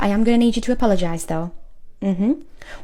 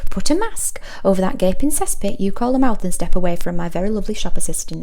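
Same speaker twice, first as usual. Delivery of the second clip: ordinary delivery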